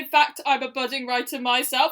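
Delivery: usual speaking voice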